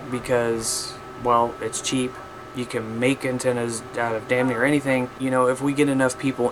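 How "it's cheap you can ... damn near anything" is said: ordinary talk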